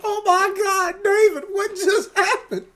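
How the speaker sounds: high, slow shocked grandma; a hammed-up grandma crying out from the floor; grandma shriek. Knocked-down grandma asks what happened, high hammed-up shriek.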